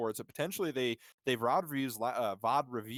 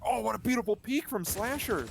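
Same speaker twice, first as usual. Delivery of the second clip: much higher, rising hype call; excited shout; 11 semitones higher than usual. Real, not acted. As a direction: erupt at the big play. Caster hypes a great play.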